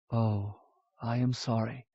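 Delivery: softly, regretful; soft apology. Acted. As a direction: say sorry quietly. Vorsheva apologizes.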